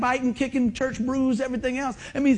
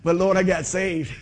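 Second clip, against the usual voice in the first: ordinary delivery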